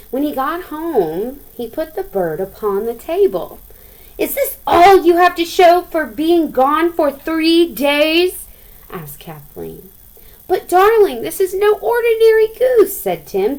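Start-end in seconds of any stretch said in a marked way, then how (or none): 4.2-8.4 high, loud scolding wife; a lightly voiced cross wife; wife's scolding. Angry wife greets Tim. 10.5-13.0 much higher, louder coaxing; high, loud eager reassurance; pleading husband. Acted. Tim pleads with his wife.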